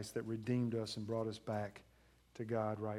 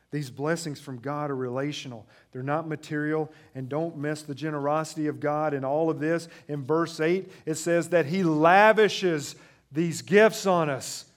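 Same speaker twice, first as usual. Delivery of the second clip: very loud, high, slowing pulpit peak; preaching at full force; 5 semitones higher, 15 dB louder than usual. Acted. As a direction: shout God's abundance over the congregation. Preaching God's generosity.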